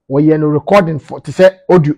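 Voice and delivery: ordinary talk, nothing marked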